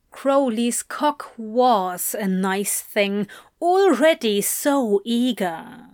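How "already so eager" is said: usual speaking voice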